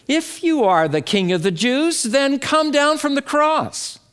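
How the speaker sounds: high taunting call, climbing in pitch; jeering. Acted. Soldiers guarding the crucified, jeering at Jesus. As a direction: jeer upward as a challenge, drawn high.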